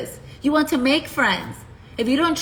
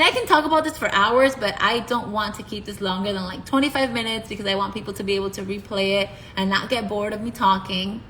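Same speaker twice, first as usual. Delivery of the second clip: ordinary talk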